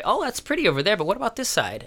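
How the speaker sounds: high, nosy inspector quip. Retold or imitated. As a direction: play the picky city inspector. Inspector voiced in retelling.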